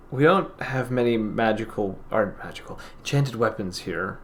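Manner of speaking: lightly voiced sad shopkeeper, softly; softly, with a hint of sorrow, halting pauses; sorrowful shopkeeper, subdued. Game master plays sorrowful shopkeeper.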